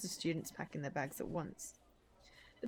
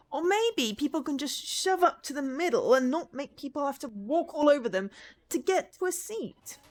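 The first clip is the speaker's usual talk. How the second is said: higher, louder exasperated complaint; exasperated rant; 8 semitones higher, 7 dB louder than usual. Acted. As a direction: vent like you're fed up. Ranting about crowded rows.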